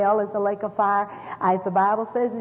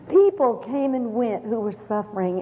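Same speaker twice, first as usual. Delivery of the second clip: ordinary talk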